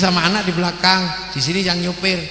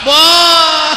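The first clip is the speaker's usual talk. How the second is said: shocked cry; 7 semitones higher, 8 dB louder than usual. Acted. Reacting to wife on neighbour's lap.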